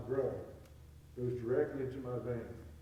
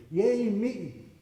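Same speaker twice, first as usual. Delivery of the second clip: a louder, higher cheer for herself; 8 semitones higher, 8 dB louder than usual. Retold or imitated. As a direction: celebrate yourself out loud. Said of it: "She goes".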